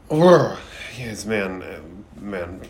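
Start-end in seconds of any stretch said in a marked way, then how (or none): 0.1-0.6 loud high groan of disgust; a sharp, loud groan of disgust. Real, not acted. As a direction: let out a real loud urgh of revulsion. Disgusted reaction to dog thrown.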